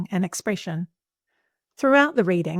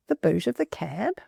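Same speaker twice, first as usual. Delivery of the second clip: mock-puzzled echo, rising at the end; playfully confused query to the class; puzzled question. Shown as a demonstration. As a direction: repeat it as if the phrase makes no sense. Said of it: "That's a bit of a strange thing to say".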